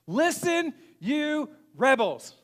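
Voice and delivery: high, loud, drawn-out mock fury; mad outburst. Moses scolding the assembly at the rock, mad outburst. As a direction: shout the insult, stretching it out. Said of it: "but he's mad".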